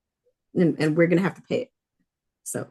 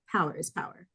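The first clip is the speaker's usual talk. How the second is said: 1 quiet menacing line; 2 semitones lower, 7 dB quieter than usual. Retold or imitated. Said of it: "slowly walked up to him".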